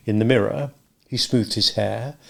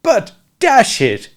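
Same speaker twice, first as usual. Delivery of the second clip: high, loud, slow protest; indignant protest; 12 semitones higher, 6 dB louder, slower than usual. A narrator's reading. Walderwick protesting to Smith, indignant. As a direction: sputter out a protest.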